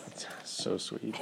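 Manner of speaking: slow, dry whispered irony; sarcastic whisper. Real, not acted. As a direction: whisper it, sweet in words only. Sarcastic reaction to rude note.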